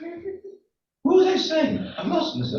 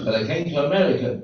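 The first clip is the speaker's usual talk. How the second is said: ordinary talk, nothing marked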